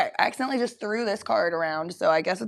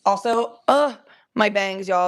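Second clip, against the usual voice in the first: ordinary talk, nothing marked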